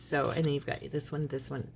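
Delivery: ordinary delivery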